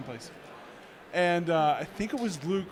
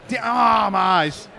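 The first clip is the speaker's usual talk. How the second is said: a hammed-up cry, louder; mock pained yell; 4 semitones higher, 8 dB louder, much slower than usual. Looking at the scary kid, mock pained yell. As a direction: yell out in fake pain over your eyes.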